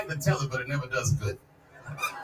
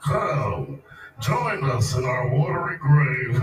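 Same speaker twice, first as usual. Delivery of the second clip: ghostly voice; 5 dB louder, much slower than usual. Acted. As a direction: moan it like a drowned ghost. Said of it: "this is an impression".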